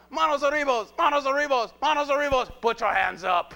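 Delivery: loud, high mock order to a crowd; high-pitched, full-volume mock command; shouted command. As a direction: shout it repeatedly as an urgent order. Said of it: "They're not sitting there going".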